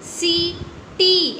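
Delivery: सीटी with both long ई drawn out; stretched reading. Shown as a demonstration. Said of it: "ऐसे ही बोलते हैं ना".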